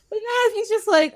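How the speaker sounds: ordinary talk, nothing marked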